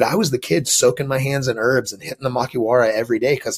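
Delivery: normal talking voice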